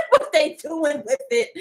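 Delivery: slow, laughing through the words; amused laughing tease. Real, not acted. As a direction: giggle through it. Laughs mid-sentence.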